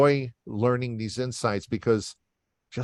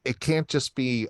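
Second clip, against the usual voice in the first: ordinary talk, nothing marked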